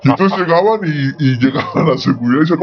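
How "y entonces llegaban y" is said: ordinary talk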